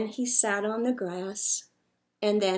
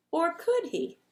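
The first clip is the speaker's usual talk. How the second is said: plain reading voice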